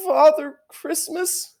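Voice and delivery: high, slow, hesitant answer; a fox stammering to the queen, high and slow; stammered. Acted. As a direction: force the name out in fear. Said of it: "stammered the fox".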